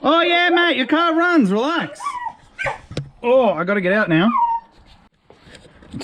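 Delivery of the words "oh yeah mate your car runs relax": high-pitched, gleeful talk to a pet; excited dog talk. Real, not acted. Talks to the overexcited dog.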